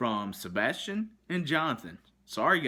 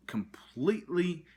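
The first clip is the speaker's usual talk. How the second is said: normal talking voice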